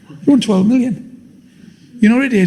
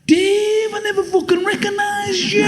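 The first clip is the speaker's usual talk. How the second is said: much higher, booming shout from above; voice of God; 13 semitones higher, 4 dB quieter, slower than usual. Acted. God calling down to David, high and shouted. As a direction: call down from the sky, surprised. Voice from the sky answers.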